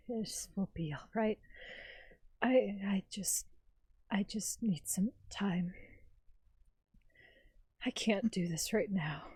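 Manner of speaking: breathless, trembling whisper; shaken whisper. Acted. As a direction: whisper it, barely holding together. Shaken character whispers, withdrawn.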